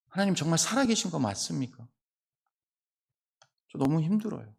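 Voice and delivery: softly voiced weary plea to God; understated cry of anguish, acted quietly; mild acted lament. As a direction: plead softly, as if worn down. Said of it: "울부짖는 탄식인 거죠".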